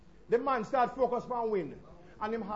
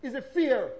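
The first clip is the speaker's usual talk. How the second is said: ordinary delivery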